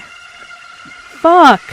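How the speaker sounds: loud, high furious curse; angry shout. Real, not acted. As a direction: blurt it out in rage. Character died, outburst.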